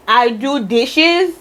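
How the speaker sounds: ordinary talk, nothing marked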